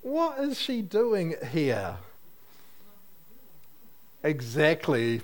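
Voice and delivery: ordinary delivery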